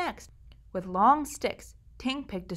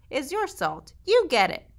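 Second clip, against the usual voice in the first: higher, sassy back talk; rude; 6 semitones higher, 4 dB louder than usual. Acted. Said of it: "Ting said rudely".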